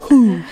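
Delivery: high breathy ooh; high ooh sound. Shown as a demonstration. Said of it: "That's what it sounds like".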